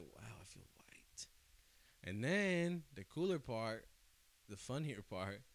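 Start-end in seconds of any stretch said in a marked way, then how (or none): 0.0-1.3 whispered aside to yourself. Retold or imitated. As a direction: breathe it out as a private joke. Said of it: "I was like".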